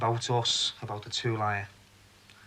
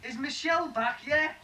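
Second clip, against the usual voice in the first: high projected question, repeated to be heard; insistent calling; 11 semitones higher than usual. Acted. Man repeats his question to unhearing Marie, calling insistently. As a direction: call it through, pitched well up.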